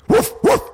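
two short high-pitched imitated woofs; dog barking. A narrator's reading. Dog hot on the kittens' heels, barking. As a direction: bark twice like a chasing dog. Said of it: "they heard a deep barking".

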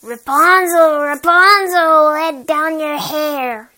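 loud slow call up a tower. Acted.

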